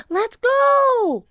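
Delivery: put-on very high, loud urgent girl; loud high cry; excited cry. A narrator's reading. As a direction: urge her brother away. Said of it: "cried Annie".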